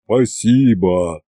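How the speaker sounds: low, slow, heartfelt bass; touched thanks in a deep drawn-out voice; Ivan's moved bass. A narrator's reading. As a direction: boom it low and slowly, moved. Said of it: "растроганно пробасил Иван".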